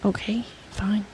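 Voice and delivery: whispered. Real, not acted. Giving in to argument.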